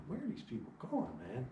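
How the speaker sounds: mild puzzled inner voice; puzzled thought. Retold or imitated. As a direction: think it half aloud. Lost while driving, inner thought.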